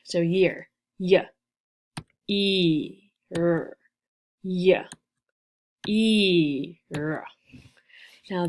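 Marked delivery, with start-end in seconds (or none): 1.0-3.8 YEAR split into separate sounds "y, e, r"; sounded out. Shown as a demonstration. Teacher counts the sounds in year, sounded out separately. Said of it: "count the sounds again". 4.4-7.3 YEAR split into separate sounds "y, e, r"; sounded out. Shown as a demonstration. Teacher counts the sounds in year, sounded out separately. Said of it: "count the sounds again".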